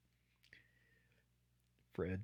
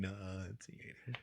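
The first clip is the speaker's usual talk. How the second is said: mumbled; 4 semitones lower, 12 dB quieter, slower than usual. Pretend. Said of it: "I was denunciating".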